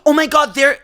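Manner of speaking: high, loud excited alert; loud pointing-out. Retold or imitated. Friend alerting her that he is there, loudly. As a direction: imitate an instigating friend calling out.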